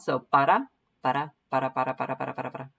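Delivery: "para" with the R tapped like a quick D; tapped Spanish R. Shown as a demonstration. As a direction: drill "para" over and over, tongue flicking the roof of the mouth. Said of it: "tap the, your tongue on the roof".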